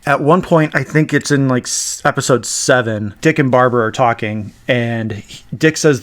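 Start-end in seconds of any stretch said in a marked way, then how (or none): none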